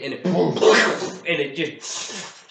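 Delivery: normal talking voice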